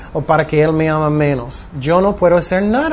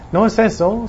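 normal talking voice

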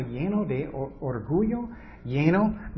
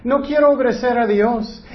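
higher and louder, petulant refusal; mock stubborn believer; 6 semitones higher, 6 dB louder than usual. Preacher imitates stubborn Christians refusing God, higher and louder. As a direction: whine it like a sulky child. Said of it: "Y muchos dicen".

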